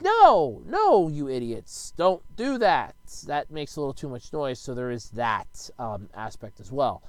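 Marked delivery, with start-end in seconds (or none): 0.0-3.0 high, loud NO dropping into slow scolding; mock-exasperated telling-off. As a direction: scold clueless neighbors, drawing out each phrase. Scolds firework neighbors in imagination.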